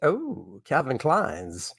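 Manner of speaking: soft, slow, wistful sigh over the jeans; softly imagined woman admiring the ad; dreamy woman. Retold or imitated. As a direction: breathe it out as if admiring the ad. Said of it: "I'd be like".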